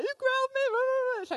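high, loud whiny mock of an upset child; mock whiny toddler. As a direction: play a wailing little girl, hammed up. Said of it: "Lisa gets really upset when I raise my voice".

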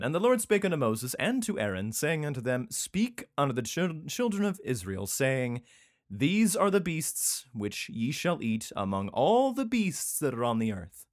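mock-solemn recital of holy law; scripture reading. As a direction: declaim the verse, stretching key words. Reads scripture aloud.

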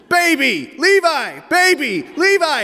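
high, loud acted back-and-forth squabble; shouting match. Father acting out two sons trading names, shouting. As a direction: fire each word back at full volume.

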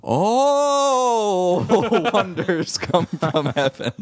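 high, drawn-out "Oh", then slow; imitating an oddly delivered line, stretched out; strange, relishing delivery. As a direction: savor the opening word with exaggerated relish. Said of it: "delivers this follow-up line is very strange".

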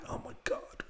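whispered aside to yourself; hushed, unvoiced dismay under the breath; whispered mortification. Embarrassed man to himself after blurting, whispered mortification.

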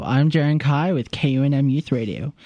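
ordinary talk, nothing marked

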